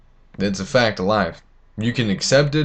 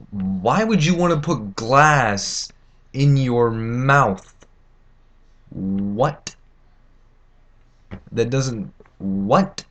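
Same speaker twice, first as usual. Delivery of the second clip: incredulous; much slower than usual. Real, not acted. Baffled by people who put fiberglass in their mouths, slow incredulous. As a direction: stretch it out like it's absurd.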